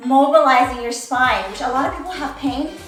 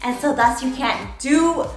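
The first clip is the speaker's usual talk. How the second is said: ordinary delivery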